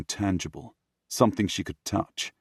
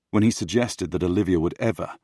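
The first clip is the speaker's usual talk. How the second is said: ordinary delivery, nothing marked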